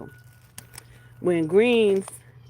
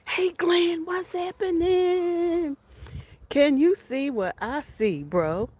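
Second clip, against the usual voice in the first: excited call; 11 semitones higher, slower than usual. Real, not acted. Calling out to neighbor Glenn.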